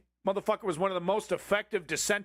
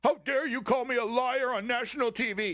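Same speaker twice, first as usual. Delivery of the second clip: higher, whiny mock of the offended Republican; mock outrage; 7 semitones higher than usual. Congressman mimicking the Republican's fake outrage, in a high whiny voice. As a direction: squeal it out like a pearl-clutching pundit. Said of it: "And he goes".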